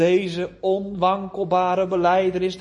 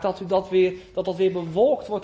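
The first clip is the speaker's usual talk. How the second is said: ordinary talk, nothing marked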